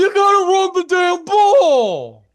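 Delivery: put-on loud high Philly accent yell. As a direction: bellow it like a rowdy Philly fan. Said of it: "do your Philly accent again".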